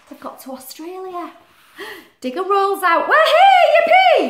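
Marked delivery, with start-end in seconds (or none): 3.1-4.3 very high, loud yippee; cheering arrival. Acted. Animals arriving in Australia, very high cheer. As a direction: cheer with delight.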